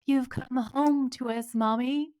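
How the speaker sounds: slow, lightly voiced creepy child; a hint of eerie twins. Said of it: "creepy Shining Twin unison".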